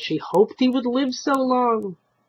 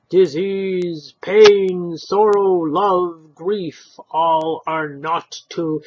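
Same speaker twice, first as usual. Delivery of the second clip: louder, slow intoned litany; slow, loud doom-laden list; solemn golem list; 2 semitones higher, 8 dB louder, much slower than usual. Acted. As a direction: toll each word.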